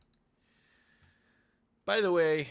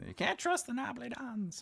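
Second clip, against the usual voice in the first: high-pitched mock dad voice; squeaky impression of a distrustful father; imitating father; 13 semitones higher than usual. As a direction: quote dad in a put-on high voice. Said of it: "my father used to always say".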